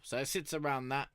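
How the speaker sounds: ordinary delivery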